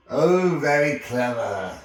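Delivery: loud, growled sarcasm; grumpy, sardonic giant; sarcastic growl. A narrator's reading. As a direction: growl mock praise. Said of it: "growled Hagrid".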